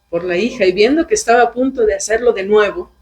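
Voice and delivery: normal talking voice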